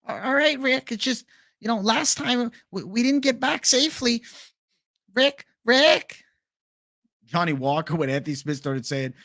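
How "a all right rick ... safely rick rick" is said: put-on much higher, anxious stammering boy; Morty impression. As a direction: ask Rick nervously like Morty. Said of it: "Do a Morty impression".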